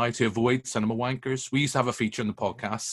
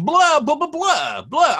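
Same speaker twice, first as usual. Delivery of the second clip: imitating kids babbling over the film, much higher and louder; high mock blah-blah of chattering teens; hammed-up impression of noisy kids talking; 7 dB louder, slower than usual. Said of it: "they just started going".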